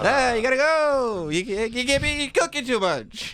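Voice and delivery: high, slow mock-stern phone voice; mock serious [name]. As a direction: play [name] trying to sound serious, slowly and high. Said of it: "hear [name] be serious, [name], on the phone".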